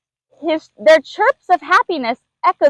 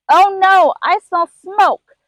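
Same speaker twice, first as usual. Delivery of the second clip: alarmed; 6 semitones higher, slower than usual. Acted. Lemur smells danger.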